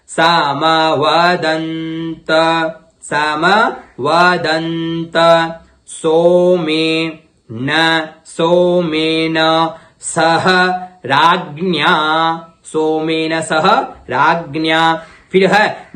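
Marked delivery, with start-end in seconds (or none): 0.1-5.1 सामवदन्त said slowly in parts; slow syllable by syllable. Shown as a demonstration. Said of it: "मैं टुकड़ों में कर रहा हूं". 5.9-15.0 slow in pieces. Shown as a demonstration. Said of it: "मैं टुकड़ों में कर रहा हूं".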